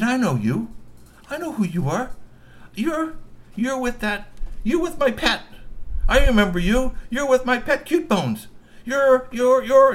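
put-on high, loud, building excited girl voice; excited troll girl. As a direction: stammer with growing recognition. Young troll girl recognizes him.

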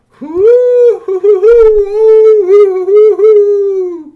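high, loud hooting call; alien singing whoops. A narrator's reading. Star-Lord greets the lost prince, high singing hoots. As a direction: sing out long alien hoots. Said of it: "a lot of singing going on".

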